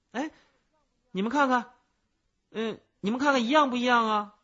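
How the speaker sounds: high, loud, triumphant challenge; showing off loudly. A narrator's reading. Child shows the cicada to everyone, loud and proud.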